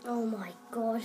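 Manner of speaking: usual speaking voice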